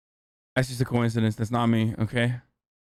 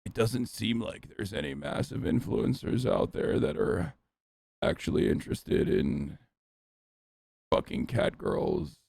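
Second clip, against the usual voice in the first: low, whispery vocal fry narrator; creepypasta narrator voice; 6 semitones lower, 6 dB quieter than usual. Retold or imitated. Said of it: "a very specific creepypasta YouTuber voice".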